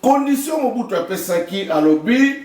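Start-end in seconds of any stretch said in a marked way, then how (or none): none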